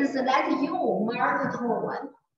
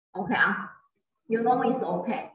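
normal talking voice

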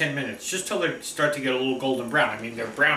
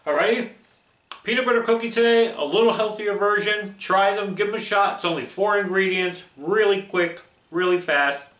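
higher, louder, bubbly outro pitch; cheerful sign-off; 6 semitones higher, 4 dB louder than usual. Acted. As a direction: sell it brightly to the viewers. Upbeat video sign-off.

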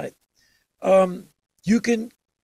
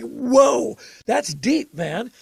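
mock awe; 5 semitones higher, 3 dB louder, much slower than usual. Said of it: "Like, like".

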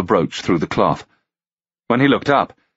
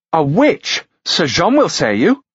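higher, sharp accusation; accusing lady; 11 semitones higher than usual. Acted. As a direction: cry out the accusation. Gentlewoman accusing.

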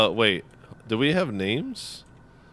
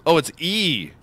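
ordinary talk, nothing marked

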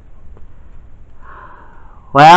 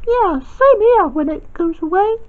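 put-on a child's toy voice, much higher; high girl voice; 12 semitones higher, 3 dB quieter than usual. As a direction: lift into a high little-girl voice, chatty. Girl figure chatting by tree.

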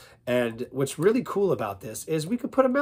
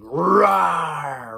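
loud, long roar; lion roar; 2 semitones higher, 9 dB louder than usual. Shown as a demonstration. Warming up as lion, tiger or bear, loud roar. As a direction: roar like a big cat. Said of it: "Like you're roaring".

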